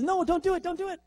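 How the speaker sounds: very high, panicked cry; desperate robot plea. Retold or imitated. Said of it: "And it says".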